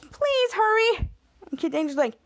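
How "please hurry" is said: high-pitched urgent plea; mock pleading. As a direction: imitate a trapped woman begging. Trapped woman pleads with rescuer.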